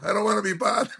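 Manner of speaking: high, laughing through the words; real laughter breaking into the rant; laughing while talking. Real, not acted. Laughing while telling the story.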